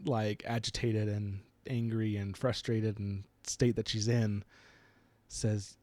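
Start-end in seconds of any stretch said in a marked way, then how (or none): none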